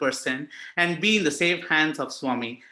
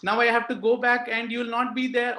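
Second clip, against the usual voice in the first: tearful plea to Swami; 6 semitones higher than usual. Retold or imitated. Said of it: "All this crying is happening".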